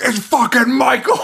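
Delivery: high-pitched imitated yell cracking into laughter; squealed mock alarm, about to laugh; mock scream. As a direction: mimic a panicked accusation, hammed up. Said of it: "he starts screaming".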